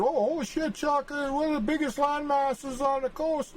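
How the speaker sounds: high squeaky mock voice of Washington; shocked Washington. Map-maker voices Washington squealing at the huge landmass. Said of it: "And then Washington said".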